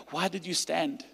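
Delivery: usual speaking voice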